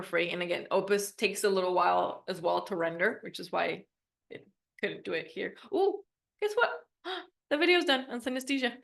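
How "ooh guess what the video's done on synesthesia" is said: high, gasping excitement; thrilled surprise at the news; excited. Real, not acted. Video finished unexpectedly, gasps.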